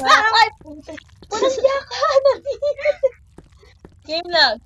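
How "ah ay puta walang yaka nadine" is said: shouting. Real, not acted. Caught in game, cries out.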